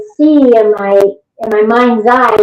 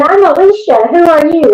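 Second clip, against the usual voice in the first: higher-pitched quick comeback; retold firm retort; 6 semitones higher than usual. Investigator answering the spirit's challenge, retorting. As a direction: throw the question straight back. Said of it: "And I said".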